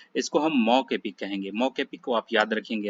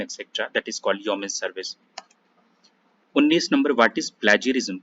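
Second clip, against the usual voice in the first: ordinary delivery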